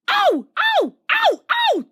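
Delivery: very high, loud shrieks; screaming trucks. A narrator's reading. Trucks jerked forward by Thomas, shrieking high. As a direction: shriek as the couplings yank. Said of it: "they screamed".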